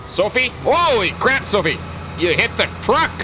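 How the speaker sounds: high-pitched, exasperated exclaiming; alarmed outcry. Pretend. Farmer to Sophie after she hits the truck, alarmed outcry. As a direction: call out in startled dismay.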